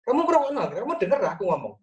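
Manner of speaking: high, reproachful wife's question; a wife catching her husband not listening; complaining wife. Retold or imitated. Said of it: "Bu Mel begitu".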